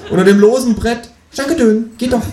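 high, loud, pleased kangaroo; bright-spirited talking animal; cheerful. A narrator's reading. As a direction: gloat happily in a high creature voice. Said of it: "sagt das Känguru fröhlich".

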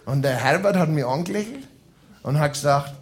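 ordinary delivery

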